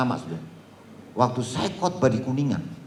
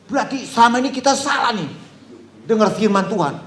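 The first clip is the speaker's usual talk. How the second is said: much higher, astonished congregant; mock dismay, pitched up high; retold shocked realization; 7 semitones higher than usual. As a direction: voice a congregant stunned at the news. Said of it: "retold congregant repeating".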